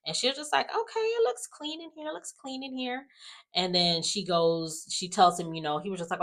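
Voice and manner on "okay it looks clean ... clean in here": much higher sneering mock of Ashley; mock her fake approval; high sarcastic impression of a girlfriend; sarcastic mimic.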